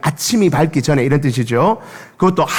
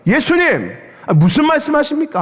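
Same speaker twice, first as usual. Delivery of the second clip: much higher, indignant cry; mock shout; 12 semitones higher than usual. Peter protesting Jesus's prediction, high indignant mock shout. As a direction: protest like a hurt disciple, pitched up. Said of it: "막 소리를 친 거예요".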